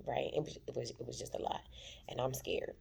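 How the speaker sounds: normal talking voice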